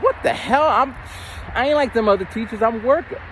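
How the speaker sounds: mildly outraged protest; indignant. Retold or imitated. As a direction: push back, offended and high. Retelling own indignant reaction.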